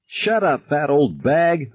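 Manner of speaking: high, slow screamed insult; shrieked insult, pitched very high. A narrator's reading. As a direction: lash out blindly through tears. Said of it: "I screamed".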